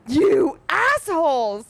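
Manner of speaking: high, drawn-out indignant cry; mock outrage. Reader scolding the authors over a twist, mock outrage. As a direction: stretch it out in scandalized disbelief.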